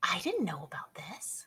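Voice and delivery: hushed whisper. Real, not acted. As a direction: whisper it under breath. Gossip whisper.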